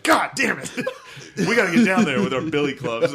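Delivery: hammed-up yelling policeman; mock cop yell. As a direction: bellow it like an angry cop. Cop yelling in retold scene.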